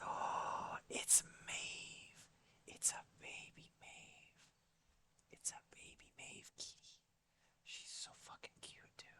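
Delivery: hushed whisper so the kitty stays asleep. Real, not acted. Streamer whispering to chat with a cat on her lap. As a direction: breathe it softly, barely any voice.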